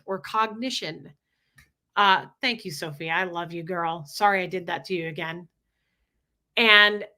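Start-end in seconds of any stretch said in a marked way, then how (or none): none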